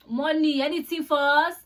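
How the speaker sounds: mimicking a pushy auntie; insistent airport auntie. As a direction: press for money in a Nigerian auntie's voice. Retold auntie demanding money.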